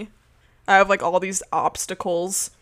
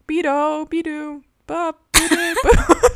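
high siren-like chant, climbing louder; siren-robot voice; 9 semitones higher, 7 dB louder, slower than usual. Retold or imitated. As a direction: sing it like a wailing siren. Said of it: "mixed up with, like, sirens".

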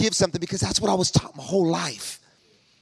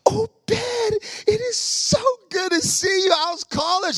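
much higher, bright gushing greeting; gushing woman. Retold or imitated. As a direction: squeal hello like an overjoyed churchgoer. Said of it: "She's like".

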